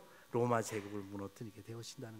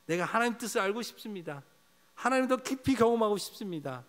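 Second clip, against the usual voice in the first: louder, higher cry of alarm; 7 semitones higher, 5 dB louder than usual. Pretend. Preacher praying for the church, crying out loud. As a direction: cry it out to God. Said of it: "탄식하기를 원합니다".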